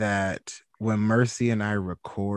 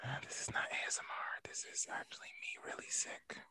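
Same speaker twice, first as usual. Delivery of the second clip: very soft, barely voiced sick whisper; 2 semitones higher, 20 dB quieter than usual. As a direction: whisper it as if too ill to talk. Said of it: "hear it in my voice like".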